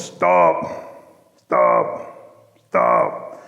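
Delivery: very low, slow frog croak; bullfrog voice. Acted. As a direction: croak it out like a big old bullfrog. Bullfrog guards the council house door.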